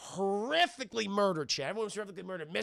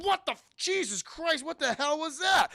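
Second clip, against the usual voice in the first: much higher, startled voice; shocked; 9 semitones higher, 4 dB louder than usual. Retold or imitated. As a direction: react as if just zapped. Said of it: "Saddam's like".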